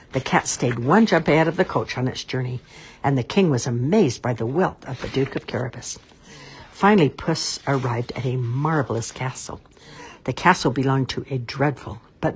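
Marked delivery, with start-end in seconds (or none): none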